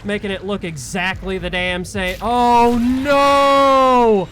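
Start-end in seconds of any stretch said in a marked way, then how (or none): 2.2-4.3 loud, high, drawn-out cries of no; panicked cries. Real, not acted. Chased gamer cries out in loud high panic. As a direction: cry out as the monster closes in.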